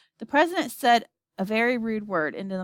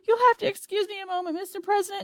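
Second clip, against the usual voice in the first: high, rushed alarm as danger swarms in; breathless fright, hurrying away; panicked; 13 semitones higher, 4 dB louder than usual. Acted. Shuckworth on the radio as creatures swarm him, panicked.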